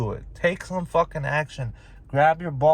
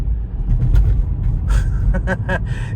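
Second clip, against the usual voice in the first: sudden loud breathy laugh burst; a scoffing outburst of a laugh; real exasperated laugh; unvoiced laugh; 6 semitones higher, 6 dB louder than usual. Real, not acted. Laughs mid rant.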